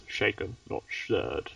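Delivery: low, soft put-on voice; catchphrase voice. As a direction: drop deep for the famous line. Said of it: "people keep demanding I keep saying these".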